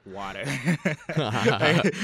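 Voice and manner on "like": laughing out the word; breaking into laughter. Real, not acted.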